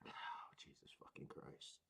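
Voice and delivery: breathy whisper of disbelief; disbelieving whisper. Retold or imitated. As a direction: mutter it under your breath, stunned. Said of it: "I was like".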